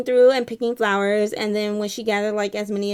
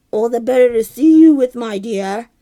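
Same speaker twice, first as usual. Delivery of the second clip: sly wolf; 10 dB louder than usual. Acted. Wolf answering in story.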